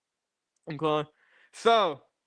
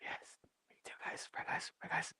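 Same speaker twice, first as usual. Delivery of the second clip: whisper; 14 dB quieter than usual. Pretend. ASMR whisper bit.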